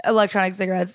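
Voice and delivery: normal talking voice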